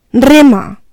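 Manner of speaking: sharp loud command; curt order. A narrator's reading. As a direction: bark it at him. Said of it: "Chea orders rowing".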